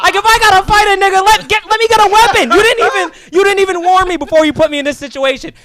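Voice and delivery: high, loud furious scolding; angry rant. Retold or imitated. Husband still yelling at his wife, furious. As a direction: keep yelling at her, outraged.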